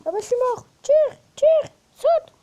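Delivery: screaming in rage at the game; furious shout. Real, not acted. Dying in game, raging.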